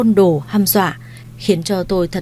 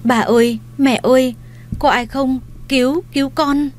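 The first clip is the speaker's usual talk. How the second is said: higher, strangled calls for help; mild mock strangled plea, rising higher; choked cry for help; 6 semitones higher than usual. A narrator's reading. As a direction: call out for grandma and mother while being choked. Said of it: "Miệng tôi ú ớ".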